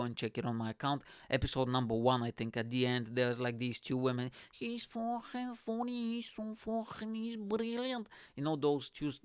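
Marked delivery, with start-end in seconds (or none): 4.5-8.1 high, slow, tipsy Scouse gush; drunk Scouse woman. Retold or imitated. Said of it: "drunk Scouse women".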